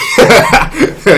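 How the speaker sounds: short airy laugh up high. Real, not acted. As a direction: laugh at your own joke. Laugh after own joke.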